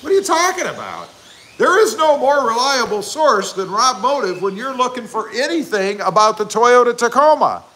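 excited mock outrage. Real, not acted. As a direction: sell it like the viewer is crazy not to know. Mock-outraged sales pitch to viewer.

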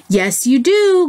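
lightly growled bear's retort; growled insistence. A narrator's reading. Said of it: "growled the Big Fluffy Bear".

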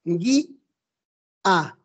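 "gui-, a" split at the hiatus by a clear pause; syllable-split pronunciation. Shown as a demonstration. Teacher showing students the hiatus, "gui-, a" split apart. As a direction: say "gui-, a" as two separate beats. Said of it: "pronuncien la palabra gui".

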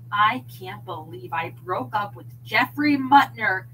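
loud, bitter snap at her husband; snapping. A narrator's reading. Wife snapping at husband in class, angry and loud. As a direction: spit it out at him. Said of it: "Nancy snapped".

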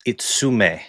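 "Itsume" said as its-oo-meh, ending falling; Japanese pronunciation. Shown as a demonstration. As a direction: say "Itsume" the Japanese way, plain Japanese vowels. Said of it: "which means sume is super".

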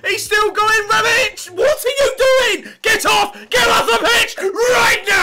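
very high, loud shouted order; exasperated yelling. Real, not acted. Commentator yelling at Rebic, exasperated shout. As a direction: scream at the player in outrage.

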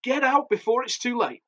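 high, urgent warning; high-pitched, desperate order; screamed warning. A narrator's reading. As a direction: urge them out in a panic. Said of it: "my auntie screamed".

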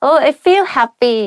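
mock-delighted little exclamation; happy. Shown as a demonstration.

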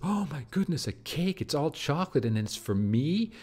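put-on high, gushing toddler wonder; three-year-old amazed. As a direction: squeal it like a child seeing his first cake. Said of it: "a three-year-old looking at a birthday cake".

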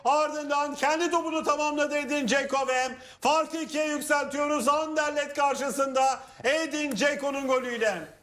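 high, jubilant goal commentary; screaming football commentator. Real, not acted. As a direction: shout the scorer's goal with joy.

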